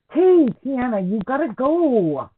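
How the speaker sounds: loud call to the dog. Real, not acted. Calling out to the dog.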